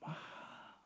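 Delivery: Mahal whispered with no voice at all, like a secret. Shown as a demonstration. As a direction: breathe out Mahal as a hushed secret to the congregation. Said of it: "Jangan keras-keras ngomongnya". (Mahal dibisikkan seperti rahasia)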